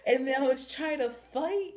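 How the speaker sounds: loud, high exasperated telling; indignant outburst. Real, not acted. Reviewer venting about the baby mama, loud indignant outburst. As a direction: vent the outrage. Outraged retelling of the twist.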